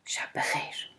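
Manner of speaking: whispered, surprised شب بخیر. A narrator's reading. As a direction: breathe شب بخیر in a startled whisper. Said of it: "با تعجب گفت".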